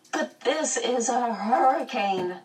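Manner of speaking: put-on high, drawn-out girl's wail; worried wail. A narrator's reading. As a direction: complain it slowly, pitched well up. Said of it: "Meg wailed".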